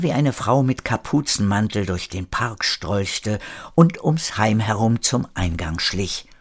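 ordinary delivery, nothing marked

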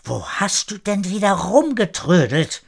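exasperated reproach, not shouted; scolding. A narrator's reading. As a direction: tell him off for being late. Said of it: "reagierte schimpfend".

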